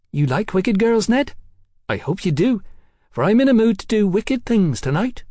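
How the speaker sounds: high-voiced cheeky London girl.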